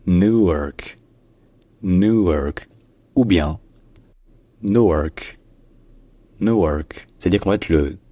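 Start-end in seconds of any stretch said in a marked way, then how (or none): 0.1-2.7 "Newark" dit nou-weurk, ou long; prononciation américaine. Shown as a demonstration. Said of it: "en anglais américain, ça se dit". 4.6-7.1 "Newark" avec première voyelle brève; variante américaine. Shown as a demonstration. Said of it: "un ou long ou ... un ou court".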